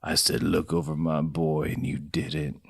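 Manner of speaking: low, soft brooding Moose voice; Moose's voice. Acted. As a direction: sink low and hurt, like a wronged dad. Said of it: "this is Moose's voice".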